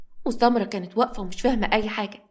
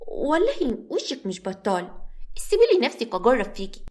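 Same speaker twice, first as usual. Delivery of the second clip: haughty; 2 semitones higher than usual. Acted. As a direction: look her over like a snob. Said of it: "بكل تناكه".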